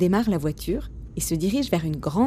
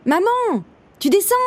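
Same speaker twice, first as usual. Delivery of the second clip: put-on high-pitched child's shout upstairs; impatient boy calling; 12 semitones higher, 4 dB louder than usual. A narrator's reading. Boy calling his mother up the stairs, impatient cry. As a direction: call out impatiently to mum, pitched way up. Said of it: "impatient, il passe la tête dans les escaliers et s'écrie".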